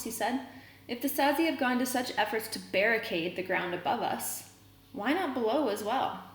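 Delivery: reading a novel aloud to the class; story reading. A narrator's reading. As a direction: read the chapter out steadily for students.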